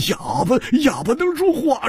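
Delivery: high, laughing disbelief; incredulous laugh. A narrator's reading. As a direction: scoff that a mute could talk. Mocking the idea a mute speaks. (难以置信地笑着说)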